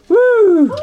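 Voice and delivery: high loud joyful whoop; excited whoop. Real, not acted. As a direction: cheer as he lands safely. Cheering as climber reaches floor.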